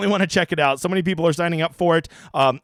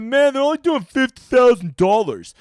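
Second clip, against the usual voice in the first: mock complaining; 6 semitones higher, 5 dB louder than usual. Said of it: "be like".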